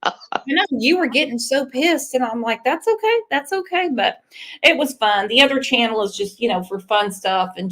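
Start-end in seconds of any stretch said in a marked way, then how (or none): 2.6-3.9 high, placating little voice; calm someone down, lightly and high; mock soothing. Host retelling how she soothed her angry moderator, mock soothing.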